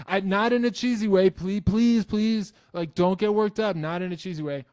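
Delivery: high, loud frantic begging; mock pleading fan. Mocking fans who beg him not to get worked up, high and loud. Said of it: "they're like".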